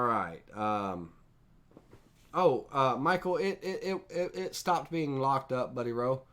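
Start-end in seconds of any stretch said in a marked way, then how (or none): 2.3-6.2 surprised. Real, not acted. Instructor to student Michael as the frozen screen unlocks, surprised and stammering. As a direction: pitch jumps up, stammering in surprise. Screen unfreezes mid-demo.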